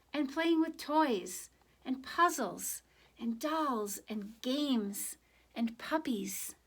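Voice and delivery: slow, savoring each word; drawn-out list of fun things. Acted. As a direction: linger over every item. Listing fun things slowly.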